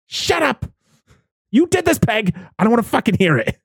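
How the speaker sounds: high-pitched, fed-up comic shout; exasperated mock yell. Neighbor telling Peg off, mock-angry yelling. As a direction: cut them off in hammed-up outrage. Acted angry retort to characters.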